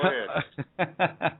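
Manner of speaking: drawn-out go ahead through a laugh. Real, not acted. Host teasing the caller, laughing slowly. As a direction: chuckle it out very slowly.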